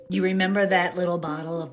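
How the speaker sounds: usual speaking voice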